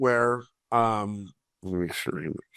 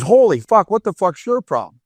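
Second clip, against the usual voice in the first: rival coach angry; 7 semitones higher, 4 dB louder than usual. Retold or imitated. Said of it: "He goes".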